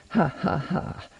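soft, forced ha-ha-ha; uneasy laugh. A narrator's reading. Chemist put on the spot by a question, uneasy laugh. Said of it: "The chemist laughed uneasily".

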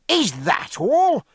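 high, slow, relieved "Is that all?"; relieved cry. A narrator's reading. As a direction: let the relief burst out. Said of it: "cried Jack with great relief".

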